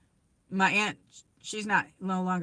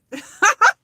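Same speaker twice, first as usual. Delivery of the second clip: laugh; 14 semitones higher, 14 dB louder than usual. Real, not acted. Laughing at her own rebellion.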